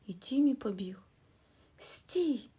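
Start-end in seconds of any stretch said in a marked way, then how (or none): 1.8-2.5 a storyteller's urgent stop; calling out stop. A narrator's reading. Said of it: "крикнув Расмус".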